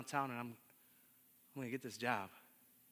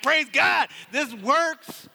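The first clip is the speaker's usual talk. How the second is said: excited praise; 9 semitones higher, 7 dB louder, much slower than usual. Retold or imitated. Overjoyed that prayer worked.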